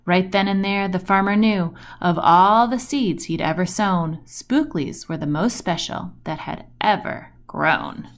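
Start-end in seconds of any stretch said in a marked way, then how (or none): none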